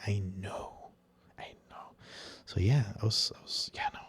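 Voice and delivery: hushed unvoiced murmur to self; whispered, almost to yourself; whispered aside. Real, not acted. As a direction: drop to a breathy whisper as if muttering.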